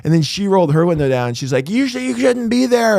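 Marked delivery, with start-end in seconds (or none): 1.7-3.0 higher-pitched imitation of the other driver; mock her scolding up high; mock yelling woman. Driver mimicking the woman who yelled, higher-pitched mock scold. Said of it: "she started yelling at me".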